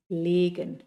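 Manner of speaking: Legen with a long closed e; German long e. Shown as a demonstration. Teacher modelling the long vowel in Legen. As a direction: stretch the e in Legen. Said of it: "Legen es colocar".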